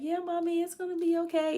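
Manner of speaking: put-on high, gentle child voice; daughter comforting. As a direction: reassure Mommy like a child. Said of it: "She's like".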